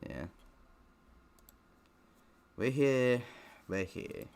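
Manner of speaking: ordinary talk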